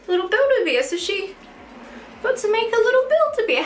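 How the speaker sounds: high-pitched delighted telling; bubbling over with real joy; excited gush. Real, not acted. Telling viewers about the handmade bear, high and thrilled.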